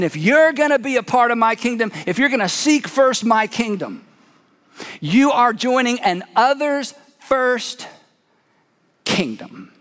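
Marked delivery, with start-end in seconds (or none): none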